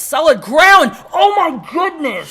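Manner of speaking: high, loud horrified cry; alarmed shout. Real, not acted. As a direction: cry out in horror. Slam onto solid ground.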